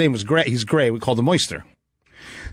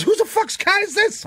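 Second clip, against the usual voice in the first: much higher-pitched mock outburst; wife's shocked yell. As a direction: play the wife suddenly horrified. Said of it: "She goes".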